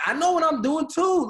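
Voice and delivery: high, loud protest, pitch climbing at too; exasperated outburst. Real, not acted. Dad protesting his wife's parenting, loud and indignant. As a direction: insist you know what you're doing too. Defending himself about the kids.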